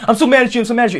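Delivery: high, whiny mock anger; mock mad whine. Josh whining at Drake, mock mad. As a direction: whine it like a sulking kid. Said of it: "Josh wasn't saying".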